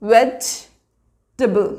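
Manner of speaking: vegetable drawn out slowly as vej-tuh-bul; vegetable said slowly, no sound for the middle e; careful vej-tuh-bul for vegetable; slow correct vegetable. Shown as a demonstration. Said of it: "ई जो होता है उसका sound नहीं आता".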